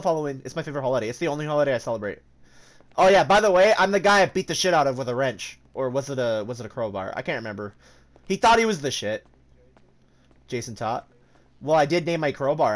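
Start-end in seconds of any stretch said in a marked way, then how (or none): none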